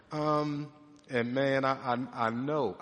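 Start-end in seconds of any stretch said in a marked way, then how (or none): none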